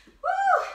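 high, loud whoop of encouragement; cheer. Real, not acted. Coach cheering her class on with a high whoop. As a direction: let out a big whoop.

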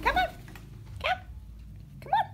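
high sing-song calls to a pet; calling the bird. Real, not acted. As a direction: coax the bird with a high call.

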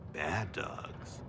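sinister low aside. Acted. Dog asylum joke, sinister aside.

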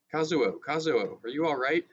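anxious shout. A narrator's reading. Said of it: "Hideki shouted".

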